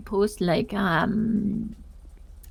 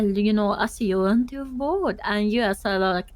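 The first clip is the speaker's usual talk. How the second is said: ordinary talk, nothing marked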